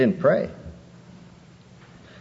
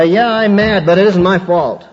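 louder, higher whiny excuse; mocking excuse; 7 semitones higher, 7 dB louder than usual. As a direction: parody the self-excusing sinner.

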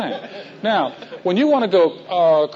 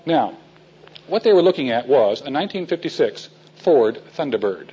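ordinary talk

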